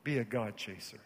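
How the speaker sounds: normal talking voice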